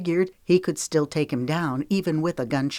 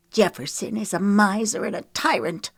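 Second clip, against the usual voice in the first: higher, bitter spat words; a touch of venom, voice raised; 5 semitones higher than usual. Acted. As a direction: spit the words out in contempt. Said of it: "she spat".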